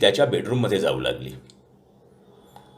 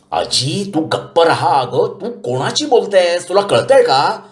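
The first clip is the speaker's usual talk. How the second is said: higher, slower, scolding; exasperated grandson; 9 semitones higher, slower than usual. A narrator's reading.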